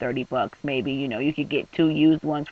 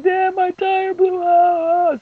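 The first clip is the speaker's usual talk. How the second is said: high, loud, tearful wail; crying to dad; 14 semitones higher, 6 dB louder, much slower than usual. Retold or imitated. Crying daughter calls her dad, high tearful wail. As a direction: sob it to your father. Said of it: "I was crying".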